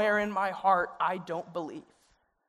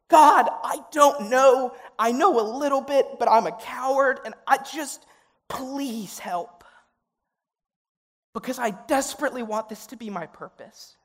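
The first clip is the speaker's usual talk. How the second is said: desperate prayer; 6 semitones higher, 5 dB louder than usual. Acted. Student on knees before God, desperate plea. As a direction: beg God from your knees.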